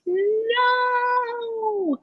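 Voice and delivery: long, high-pitched mock scream; imitated scream. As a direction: imitate a girl screaming out in protest. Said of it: "a young girl, she screams".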